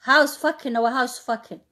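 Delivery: ordinary talk, nothing marked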